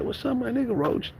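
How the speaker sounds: high-pitched imitation of himself as a kid; kid's greeting back. Kid answers his friend's greeting, high mock-excited squeal. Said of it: "And I'm like".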